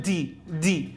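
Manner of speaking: دي with a little breath leaking on د; weakly voiced dal. Shown as a demonstration. Teacher shows a milder mistake, breathy د in دي. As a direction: soften the د of دي with escaping air. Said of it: "وهذا كله خطأ".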